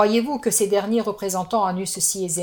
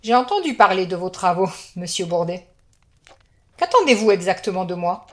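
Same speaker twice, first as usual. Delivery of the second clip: cold amused contempt; 10 semitones higher, 8 dB louder than usual. Acted. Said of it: "une froideur teintée de mépris amusé".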